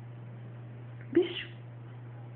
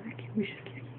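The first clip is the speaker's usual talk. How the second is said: whispering; 17 dB quieter, much faster than usual. Real, not acted. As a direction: whisper it softly, coaxing. Sussurra ao bebê brincando.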